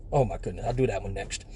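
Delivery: ordinary talk, nothing marked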